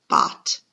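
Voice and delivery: "bot" said low and firm on its own; isolated syllable. Shown as a demonstration. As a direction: drop the voice for "bot". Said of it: "spell that first syllable".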